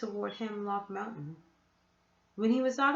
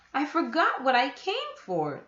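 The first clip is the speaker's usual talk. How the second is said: put-on truthful boy; 4 semitones higher, 6 dB louder than usual. A narrator's reading. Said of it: "Jonathan said truthfully".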